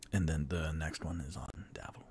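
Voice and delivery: hushed whisper; secretive tavern whisper. Acted. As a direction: whisper the offer under your breath. Conspiratorial aside at the table.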